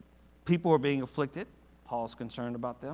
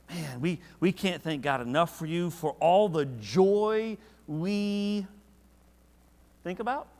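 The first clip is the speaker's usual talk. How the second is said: rising higher and louder, stretched out slow; passionate; 3 semitones higher, 5 dB louder than usual. Shown as a demonstration. Paul thanking God for the church, with passion. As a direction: pour out heartfelt gratitude. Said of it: "now he's like".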